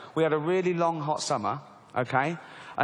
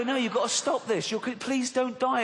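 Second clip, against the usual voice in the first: high, urgent begging, slowing at the end; hammed-up desperate plea; mock pleading; 6 semitones higher than usual. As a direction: implore a loved one to stop. Acts pleading with addict relative.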